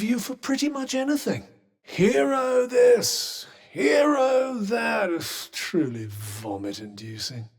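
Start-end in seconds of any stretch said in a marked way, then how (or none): 1.8-5.1 high, drawn-out mock adulation; sneering at hero worship. Mocking someone's praise as hero. 5.1-7.5 disgusted. Acted. Disgust after mocking the hero praise.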